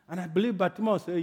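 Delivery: normal talking voice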